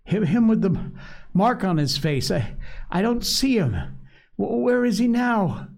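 Piggy stumbling in fear; Piggy, frightened. A narrator's reading.

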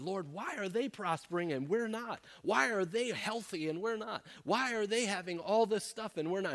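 high-pitched whiny grumble; complaining, whining. Acted. Asaph complaining to the Lord as the wicked prosper, whining. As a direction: whine each question up high, acting frustrated. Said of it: "he's just complaining and grumbling and just whining".

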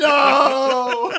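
high, loud melodramatic no; mock anguished cry. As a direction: wail it like a movie tragedy.

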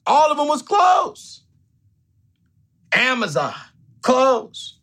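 high, stretched disbelief on clothes; incredulous outburst. Retold or imitated. Podcast host retelling guests' regrets, high incredulous outburst. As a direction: throw the answer out high like it's absurd. Mocking the women's answers.

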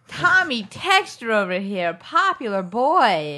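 loud, slow, drawn-out teasing; mocking heckle. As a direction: call out the texter with mock scorn. Mocks someone texting.

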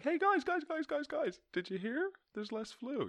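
very high, squeaky mock excitement; hammed-up falsetto eagerness; excited news-bearer. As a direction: put on a silly high-pitched messenger voice. Mock bearer of trivial good news.

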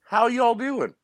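high-pitched Southern twang; put-on high North Carolina drawl; North Carolina accent. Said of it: "But I read it in".

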